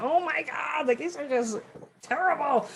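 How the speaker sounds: high-pitched, hammed-up dismay; acted mortification. Photographer reliving bad background shots, acted mortification. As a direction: act aghast, pitched well up. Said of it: "I was just, like, mortified".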